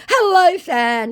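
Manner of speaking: drawn-out, high, loud hello; fake celebrity greeting. Fake [name] hitting up a fan, exaggerated greeting. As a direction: call it out slowly in a mock-grand greeting.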